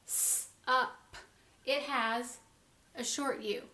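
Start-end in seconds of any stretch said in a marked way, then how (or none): 0.0-1.1 first syllable split into s, up; sounding out. Shown as a demonstration. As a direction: hiss the s, then say up separately. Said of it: "This syllable has".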